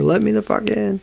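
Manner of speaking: half-hearted cry to be let in; mock pleading. Imitates buddy banging on door.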